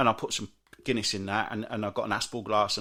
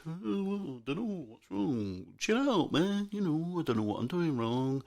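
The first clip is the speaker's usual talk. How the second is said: high, drawn-out clueless mumbling, softer at the ends; gone-out oblivious driver; 4 semitones higher, 4 dB quieter, slower than usual. Retold or imitated. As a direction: play someone dopily unaware of their mistake. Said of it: "they just look at you, gone out".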